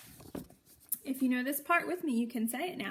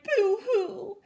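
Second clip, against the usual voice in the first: a hammed-up high, loud boo-hoo; fake-cry like a cartoon rat; mock crying; 7 semitones higher, 8 dB louder than usual. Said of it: "who cried".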